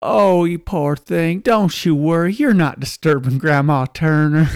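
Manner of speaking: reassuring landlady. Retold or imitated. Boarding house owner comforting the girl, reassuring landlady voice. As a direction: put on a fussing, consoling woman. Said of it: "And the woman goes".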